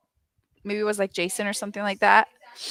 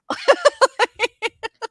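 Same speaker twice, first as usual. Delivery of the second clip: laugh; 5 dB louder than usual. Real, not acted. Laughing at own story.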